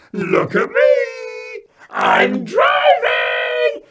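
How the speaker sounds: put-on very high, slow toddler shriek; shouting. Three-year-old shouting out at family from car, gleeful. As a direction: yell it out with huge pride. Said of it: "Then Eddie shouted out at us".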